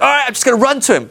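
high, keen mock eagerness; eager enthusiasm. Peter about to run to Jesus, hammed-up eagerness. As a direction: play it raring to go.

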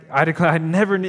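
a rejecting judge's tone, hammed up; imitated angry Jesus; mock angry tone. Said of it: "with an angry tone".

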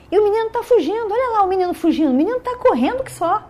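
high, loud, quickened running commentary; excited onlooker. Acted. Soldier to his comrades about the fleeing boy, excited. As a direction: point out the runaway, pitched high and urgent.

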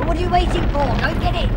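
loud, impatient order; impatient partner shouting at Jack to go; urgent command. Acted. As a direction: push him to go, loudly.

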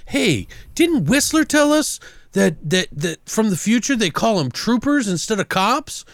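high-pitched mock questioning; lightly voiced cop. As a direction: lightly put on a puzzled cop's voice. Acts out the movie cop.

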